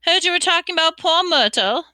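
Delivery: high, loud mischievous voice; teasing poltergeist. A narrator's reading. Poltergeist taunting students about their gossip, teasing. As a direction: needle them with gleeful mischief.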